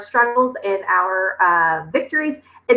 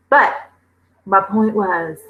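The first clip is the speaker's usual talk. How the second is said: normal talking voice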